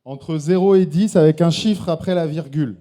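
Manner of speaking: loud emcee announcement; announcing loudly. Real, not acted. Host explaining scoring to the jury, loud. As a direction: announce to the whole room.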